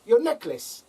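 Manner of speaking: put-on "your necklace" repeated, high South African accent; mock South African repeat. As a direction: imitate the masseuse insisting. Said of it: "It's all in the accent".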